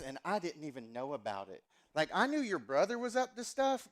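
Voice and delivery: a son gently imitating his mom's Southern accent. Pastor retelling his mom's visit, in a light Southern mom accent. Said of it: "every time I do my mom's accent".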